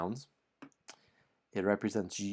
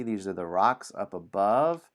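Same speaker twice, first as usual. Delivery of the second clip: usual speaking voice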